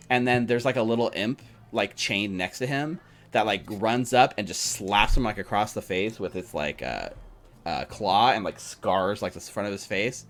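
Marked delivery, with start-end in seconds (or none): none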